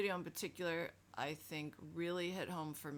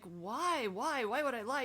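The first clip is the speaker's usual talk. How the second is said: put-on raised, high puzzled kid voice; pleading child; 6 semitones higher, 5 dB louder than usual. Child questioning the scolding adult, a touch high and pleading. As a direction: ask why like a wronged little kid.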